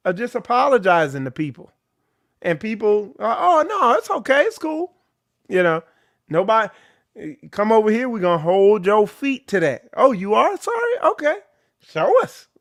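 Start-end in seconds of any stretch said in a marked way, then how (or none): 3.4-4.9 high, light "oh no, it's okay"; high, airy imitation of easy forgiveness; mock forgiving voice. Mimicking people who excuse it. 10.0-12.5 higher, sarcastic "oh, you are sorry? show us"; mocking challenge. Mocking the apologizer, demanding proof.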